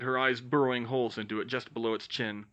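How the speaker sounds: usual speaking voice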